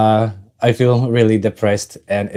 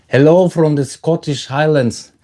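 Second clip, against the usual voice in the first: a light mock Scottish accent; 5 semitones higher than usual. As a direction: try a Scottish lilt. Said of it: "Sorry for butchering your accent".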